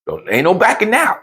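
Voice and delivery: high teasing wife voice; high-pitched wife laying down the deal. Retold or imitated. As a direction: push up high and playful as the wife. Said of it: "It's like".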